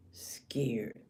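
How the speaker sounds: low, softly breathed repeat; hushed emphasis. Real, not acted. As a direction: drop to a near-whisper for effect.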